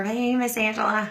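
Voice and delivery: ordinary talk, nothing marked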